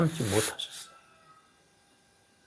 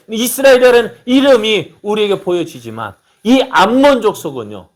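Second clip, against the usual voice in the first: much higher, louder fervent preaching; preaching at full force; 10 semitones higher, 8 dB louder than usual. Acted. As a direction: build to a fervent climax.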